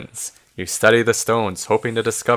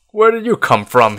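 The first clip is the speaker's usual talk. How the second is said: louder, higher, mildly angry question; angry demand; 9 semitones higher, 5 dB louder than usual. A narrator's reading. Wizard confronting the returned travelers, angrily. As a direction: read it as an irritated interrogation. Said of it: "he asks angrily".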